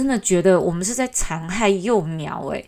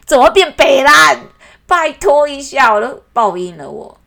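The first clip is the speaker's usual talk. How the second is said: loud, incredulous outburst; appalled and loud, slowing on 拜托一下; exasperated disbelief; 7 semitones higher, 9 dB louder than usual. Real, not acted. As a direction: throw up your hands at the absurdity. Outraged at mangled name.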